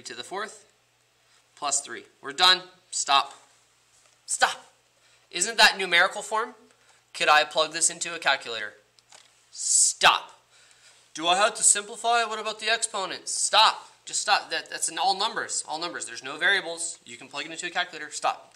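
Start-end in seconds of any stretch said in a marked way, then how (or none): 2.9-4.7 louder, higher repeated stop; insistent stop. Acted. Teacher cuts off worries. 5.3-13.3 worried student voice. Pretend. 13.3-18.5 louder, higher repeated stop; insistent stop. Acted. Teacher cuts off worries.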